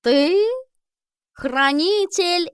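slow, high drawling cat. Acted. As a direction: stretch every word like a lazy cat. Albus the cat speaks.

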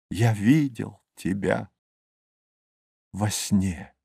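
wistful, fading. A narrator's reading. Narrator recalls the kingdom seen in a dream, fading. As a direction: let it die away softly.

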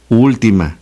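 normal talking voice

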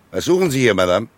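loud, high demand to a stranger; startled inspector barking a challenge; sharp challenge. Acted. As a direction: call her out sharply. Inspector confronts intruder at car.

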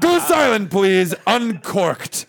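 drawn-out mock-pretentious order, starting high; pretentious command. Friend mocking the host's beer order, pretentious command. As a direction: imitate a snooty customer giving orders. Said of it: "You got so pretentious".

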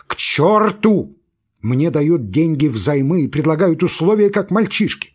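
rising from complaint to a high, loud outburst; building furious shout. Acted. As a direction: explode by the end, slowing on the last words. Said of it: "крикнул Лаевский".

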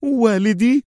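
very high, incredulous query; indignant disbelief. A narrator's reading. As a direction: throw it back in high-pitched indignation. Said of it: "يهتف في استنكار".

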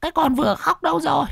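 very high, fast eerie spirit voice; ghost giving orders. Acted. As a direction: demand it like a greedy ghost. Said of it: "nó còn vừa ra lệnh".

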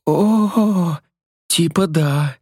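high cry, then sheepish; hammed-up moan trailing off; fake climax.

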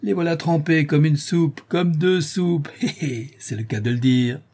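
high, crowing portress voice; slow, relished mockery; gloating glee. A narrator's reading. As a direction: crow triumphantly, with a little chuckle. Portress gloats over soaked bailiffs.

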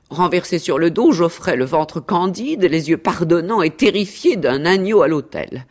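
mock-tragic storytelling with swelling phrases; theatrical, lilting account of an ordeal; self-dramatising cat. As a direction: recite your suffering with relish.

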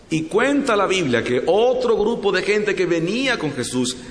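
ordinary talk

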